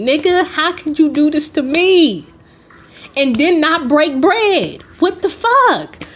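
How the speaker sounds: hurt, incredulous accusation; indignant outburst. Retold or imitated. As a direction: let the betrayal build into outrage. Confronting Drew about the theft.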